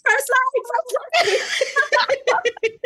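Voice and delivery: high, giddy cheer through laughter; hammed-up thrilled squeal; mock excitement. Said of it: "At the end, I was like".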